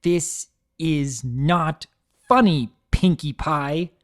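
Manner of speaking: very slow, each word hit hard: This is not funny; every word stressed. Acted. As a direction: spell it out word by word, furious. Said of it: "Twilight emphasized every word".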